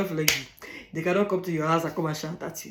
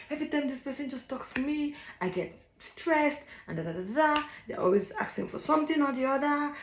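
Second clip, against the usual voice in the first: stressed-out whining; 7 semitones higher, 4 dB quieter than usual. Retold or imitated. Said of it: "I'll be like".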